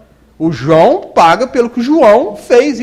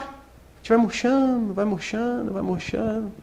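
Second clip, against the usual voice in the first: each repeat softer until it dies out; fading away; 2 semitones higher, 8 dB quieter, slower than usual. Shown as a demonstration. Speaker shows listeners how we wilt, fading softer. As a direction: let the voice wilt with the words.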